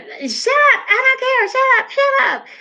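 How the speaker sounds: high, mock bratty shriek at her dad; spoiled girl yelling. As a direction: play the spoiled teen screaming back. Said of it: "and she just got".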